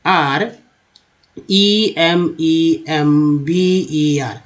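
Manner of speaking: letters spelled out very slowly; slow spelling. Shown as a demonstration. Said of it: "example ले लीजिए remember का". (धीरे-धीरे अक्षर बोलते हुए)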